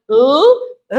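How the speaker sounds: "L" as a long held lll; letter sound L. Shown as a demonstration. Teacher introducing L and R, held lll. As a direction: sustain the tongue-tip sound for "L". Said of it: "agora vamos para L e R".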